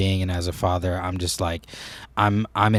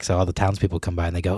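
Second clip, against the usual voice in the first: ordinary talk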